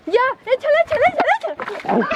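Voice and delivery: very high frantic egging on; excited urging. Acted. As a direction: cheer them to grab him. Urging during the chase.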